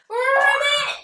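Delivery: high, loud frog ribbit; ribbit shout. Pretend. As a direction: shout ribbit as you hop. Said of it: "we're gonna ribbit when we hop".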